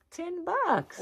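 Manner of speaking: price echoed way up high; high delighted squeak. Real, not acted. Vlogger thrilled to viewers about a bargain, high squeak.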